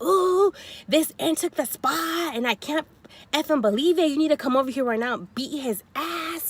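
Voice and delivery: imitating a furious caller; mock angry call. Rival calls her boyfriend, imitating an angry rant. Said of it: "she's like".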